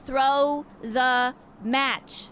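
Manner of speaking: each word dragged out for a slow listener; slow spelled-out repeat. Acted. Lola drags out her plan for Gustav, very slow and exasperated. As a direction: spell it out very slowly to a dim partner. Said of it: "You really do need to repeat something a billion times".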